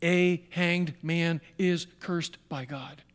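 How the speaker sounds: slowly, each word weighed; slow reading. Shown as a demonstration. Preacher slowing down to read the key verse to the congregation. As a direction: lay out every word slowly. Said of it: "get ready, here it is".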